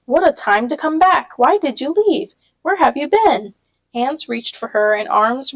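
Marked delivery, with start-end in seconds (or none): 0.1-3.5 higher and louder, eager crowd; excited crowd voice; excited questions. A narrator's reading. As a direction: pepper her with questions.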